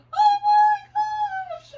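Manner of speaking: very high, loud drawn-out wail; crying scream. Retold or imitated. Mom hearing from missing daughter, crying and screaming. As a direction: re-enact a hysterical scream of relief. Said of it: "I'm crying and screaming".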